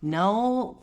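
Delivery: plain reading voice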